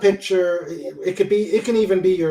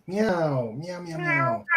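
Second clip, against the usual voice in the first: cat meows; 2 semitones higher, 6 dB quieter, much slower than usual. Pretend. Cat talk after Kitty.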